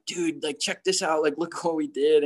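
playing the overexcited rookie showing off clips; annoying fired-up kid. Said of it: "that annoying, fired up kid".

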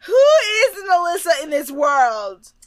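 high, incredulous wail; mock bafflement. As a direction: cry it out in exaggerated disbelief. Repeats the baffled question.

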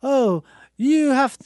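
put-on Italian fan gushing in accented English. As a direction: play an Italian smitten with Westerns. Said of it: "Italians are like".

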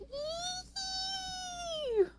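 thin, very high squealed scream; long high scream. A narrator's reading. May May screams as the horse bolts, squealing high. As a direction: squeal in a sustained high pitch. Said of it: "May May screamed".

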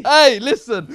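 loud, high call for attention. Real, not acted. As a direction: shout over him. Loud pushback during banter.

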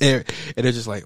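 ordinary talk

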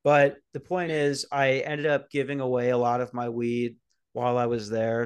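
ordinary talk, nothing marked

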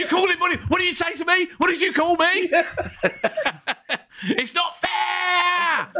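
put-on stamp your feet like a child; childish tantrum. Arteta ranting, childish.

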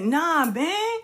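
high, pleading retelling; Will pleading with his friend; earnest Will. Retold or imitated. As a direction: plead to share his success. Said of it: "He said".

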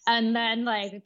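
normal talking voice

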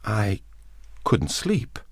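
high, hesitant excuse; nervous. A narrator's reading. Woman explains why she is up, nervous. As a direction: falter after the first word, nervous. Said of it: "she said nervously".

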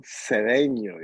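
Cedeno said the Spanish way, se-REN-yo with a tapped r; Latinized name. Shown as a demonstration. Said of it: "you gotta Latinize it".

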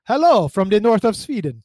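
put-on Swedish accent, lilting. Host reads listener's message from Sweden, in a Swedish accent. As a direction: read it with a mock Swedish accent. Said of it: "I'm gonna read an accent".

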